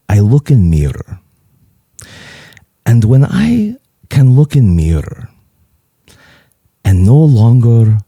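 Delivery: solemn Greek impression, long pauses; measured, weighty words in a Greek accent; serious Greek accent. Father-in-law explains confessing to a mirror, serious Greek accent. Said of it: "he gets real serious".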